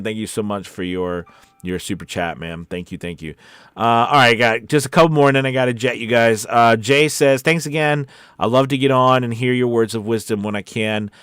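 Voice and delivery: ordinary delivery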